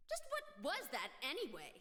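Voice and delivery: normal talking voice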